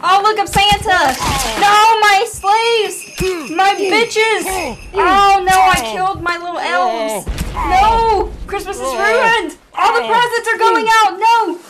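high-pitched, hammed-up dismay; mock-panicked wailing. Santa seeing Christmas ruined, mock-panicked wailing. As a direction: cry out in overdone horror. Playing Santa losing elves.

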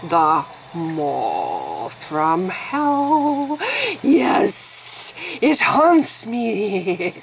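spooky voice. Pretend. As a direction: drag it out like a ghost story. Spooky mock horror of mole.

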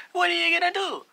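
high, loud goading; mocking taunt. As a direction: dare them to start a fight. Mario taunts Luigi before fight.

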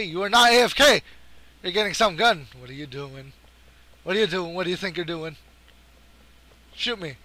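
ordinary talk

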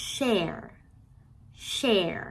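"share" with a long stream of air on SH; drawn-out SH. Shown as a demonstration. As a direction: let the SH in "share" hiss on before the vowel. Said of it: "เราสามารถจะลากเสียงขนาดไหนก็ได้". ("share" ลากเสียง sh ยาว)

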